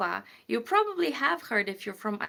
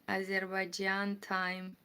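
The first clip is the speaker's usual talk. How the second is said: normal talking voice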